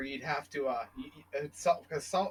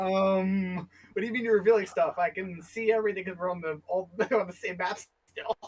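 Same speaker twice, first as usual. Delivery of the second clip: mock confused player; 6 semitones higher than usual. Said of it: "And I was like".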